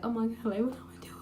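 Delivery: hushed, whispery crying imitation; mock ugly cry. Standing on her bed scared to tell mom, mock whispered crying. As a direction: act out crying to yourself, thin and whispered. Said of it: "it's one of them ugly cries".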